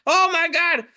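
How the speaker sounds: high-pitched comic horror; panicked cry. Acted. Carver horrified by a screaming pumpkin, high panicked cry. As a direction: exclaim in high cartoon panic.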